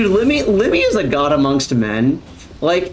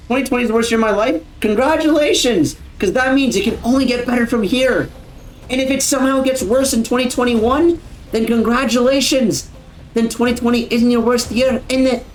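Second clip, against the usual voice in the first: higher, lightly Scottish imitation; not-quite-full Scottish accent; partial Scottish accent; 6 semitones higher than usual. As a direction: quote it with a half-attempted Scottish accent. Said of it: "sounds a lot better with a Scottish accent".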